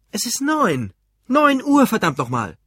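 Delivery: high, loud exasperated shouting; angry outburst. A narrator's reading. Husband who overslept yelling at his family, angry. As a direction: shout it in outraged disbelief.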